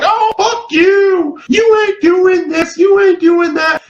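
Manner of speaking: shrill, high, slow mock-sobbing shout; mocking whiny girl. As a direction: whine it like an offended girl bursting into tears. Mocking girls who get called out.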